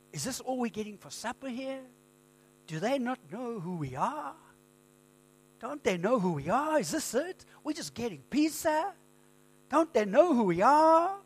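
high, whiny American accent mocking the band. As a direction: put on a spoiled American accent. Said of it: "Yes, in an American accent".

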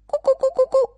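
high, loud hen clucks; 母鸡高声咕咕叫; hen clucking. Acted. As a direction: cluck away like a chicken. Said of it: "鸡说".

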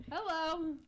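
odd, off-kilter hello; said weird. Shown as a demonstration. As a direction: greet them in a strange way. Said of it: "I feel like you said it weird again".

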